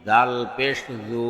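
final "জু" cut short; quick short vowel. Shown as a demonstration. Teacher demonstrating normal pesh, short "জু". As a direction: keep the last "জু" brief, not held. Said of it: "তাড়াতাড়ি করতে হয়".

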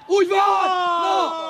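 high, loud sideline cheer; approving shout. Real, not acted. Coach praises the move during play, approving shout. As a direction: shout your approval across the pitch.

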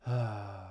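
a soft tired sigh out through the mouth; weary sigh. A narrator's reading. Gran sighing over the family's busy schedule, a soft weary sigh. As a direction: let out a gentle weary sigh. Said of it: "she added with a sigh".

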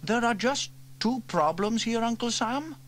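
put-on high boy voice, unsure; young boy James shrugging it off. A narrator's reading. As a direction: voice the young brother. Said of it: "said James".

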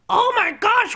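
ordinary talk

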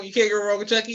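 normal talking voice

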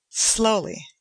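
plain reading voice

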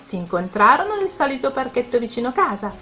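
plain reading voice

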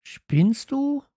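very high, loud outburst of disbelief; calling out. A narrator's reading. Girl rejects friend's trip idea, exclaiming. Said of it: "rief Steffi".

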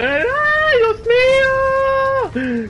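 very high, loud frantic scream; panicked shrieking. Real, not acted. Panicked shout in Spanish while fleeing.

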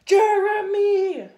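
very loud, high, drawn-out call; bellowed name. Acted. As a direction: roar it like an angry mother bird. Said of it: "bellowed the big canary".

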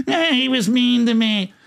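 lightly voiced slow, high complaint; hammed-up little complaint to an uncle; complaining daughter. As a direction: drag it out as a mock tattletale.

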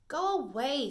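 high, loud dog telling off a puppy; Rosie. Acted. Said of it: "said Rosie".

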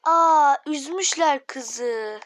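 loud, drawn-out dismayed exclamation; loud pitying "aa" that trails out slowly; dismayed outcry. Real, not acted. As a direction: cry out in dismay, stretching the words. Sees game girl is upset.

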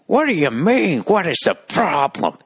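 put-on high-pitched, indignant old-guy squawk; mock an outraged old neighbor, dragging out the words; hammed-up irate geezer; cranky old man. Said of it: "His face is all fucking twisted up".

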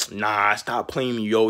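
ordinary talk, nothing marked

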